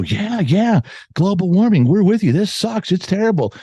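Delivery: put-on high, eager kid parroting the teacher; mock eager student. Kids to the teacher, high mock eager agreement. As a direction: play a kid agreeing far too quickly.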